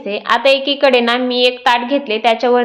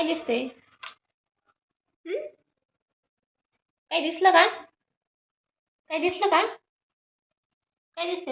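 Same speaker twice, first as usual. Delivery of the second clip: higher, soft cooing questions; 5 semitones higher, 10 dB quieter than usual. Real, not acted. Mother asks her baby what he sees, soft coo.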